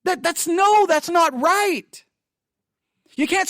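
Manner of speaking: high, loud protest; indignant. Retold or imitated. To the pastor, a high loud indignant no. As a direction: refuse it, pitch up and loud. Continuing the confrontation.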